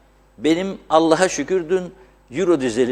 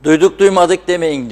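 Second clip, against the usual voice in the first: town crier; 4 semitones higher, 7 dB louder than usual. Retold or imitated. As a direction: call it out like a town crier to the square. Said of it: "davulcu çok güzel söylemiş". (tellal gibi yüksek sesle duyuru)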